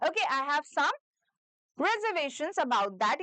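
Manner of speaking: slow, every word clear; slow dictation. Shown as a demonstration. A teacher dictating slowly for students to repeat. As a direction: let each syllable of "reservations" land. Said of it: "ये sentence use कर सकते हो".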